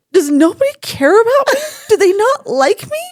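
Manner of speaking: high, loud mock wail; imitated tearful whine. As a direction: whine like a hurt, weepy patient. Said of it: "she starts crying".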